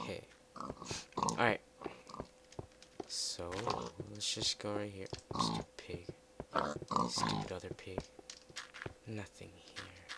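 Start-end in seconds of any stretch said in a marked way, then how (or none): 5.4-8.0 much lower, dragged-down voice; low, grumbly insult; mock deep grumble. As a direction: growl at the pigs.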